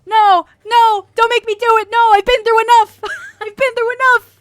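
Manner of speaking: hammed-up panicked no, loud and high; mock horrified protest. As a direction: squeal it out like you're begging off. Reacting to crude chat, mock horror.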